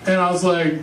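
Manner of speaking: ordinary delivery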